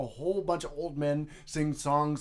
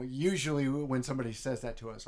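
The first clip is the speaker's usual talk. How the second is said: ordinary talk, nothing marked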